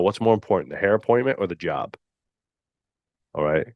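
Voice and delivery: normal talking voice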